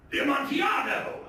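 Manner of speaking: high, startled exclamation; Fortunato's amazed outburst; astonished cry. A narrator's reading. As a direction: blurt it out in shock. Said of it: "ejaculated my friend".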